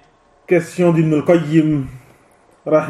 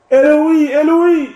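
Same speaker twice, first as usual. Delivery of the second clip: anguished cry; 9 semitones higher than usual. Retold or imitated. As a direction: call out in anguish from the cross. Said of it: "et qui dit". (cri d'angoisse, aigu et fort)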